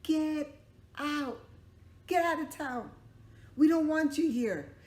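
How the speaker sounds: loud high angry shout; angry crowd. Acted. Townsfolk shouting the father and son away. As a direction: chase them out of town. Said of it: "everybody got together and said".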